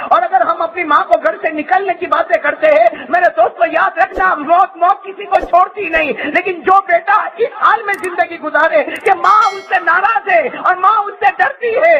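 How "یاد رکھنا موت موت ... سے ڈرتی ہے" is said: impassioned shout. Real, not acted. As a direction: cry out the warning at full force. Preacher's warning climax. (اونچی آواز میں روتی ہوئی پرجوش للکار)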